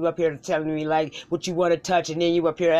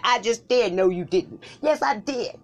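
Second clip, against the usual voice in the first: bickering; 6 semitones higher, 5 dB louder than usual. Acted. Comic bickering back and forth.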